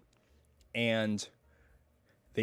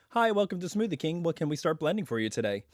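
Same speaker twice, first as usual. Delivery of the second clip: higher-pitched recorded woman's greeting voice; female drive-thru greeter; 5 semitones higher than usual. Retold or imitated. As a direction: imitate an automated woman taking orders. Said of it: "there's a woman that says".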